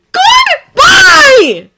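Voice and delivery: high, loud shouted goodbye. A narrator's reading. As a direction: yell it on the way out the door. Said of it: "Peter shouts".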